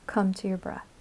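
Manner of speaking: ordinary talk, nothing marked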